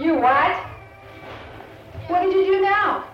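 loud, high scolding outburst; indignant parent. Acted. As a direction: react as an exasperated parent, voice jumping up. Parent reacting to child's trouble.

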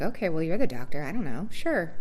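mock-agreeable sure-whatever tone; shrugging compliance. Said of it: "So I'm like".

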